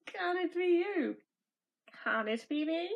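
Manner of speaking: ordinary delivery, nothing marked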